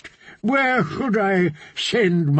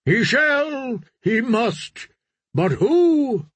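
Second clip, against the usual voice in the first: crowd cry; 9 semitones higher, slower than usual. Acted. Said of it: "the drowned men cried".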